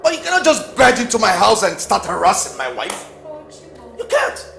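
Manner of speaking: high and loud, building to a yell; rising angry shout. Acted. Husband defending wife, escalating.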